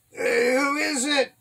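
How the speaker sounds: high, drawn-out demand. A narrator's reading. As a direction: call back slowly, pitched well up. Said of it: "Abner demanded".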